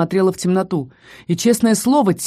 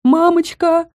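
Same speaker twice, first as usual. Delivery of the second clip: high, drawn-out frightened "Мамочка!"; frightened drawn-out cry; 10 semitones higher, 3 dB louder, slower than usual. A narrator's reading. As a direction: wail "Мамочка!" high in fear. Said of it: "протянула Хэдди".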